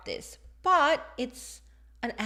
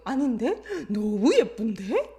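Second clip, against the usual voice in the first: playful contradiction; 4 dB louder, much faster than usual. Retold or imitated. Teacher not letting the toddler win, playful contradiction. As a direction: keep the argument going playfully. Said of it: "She's being playful".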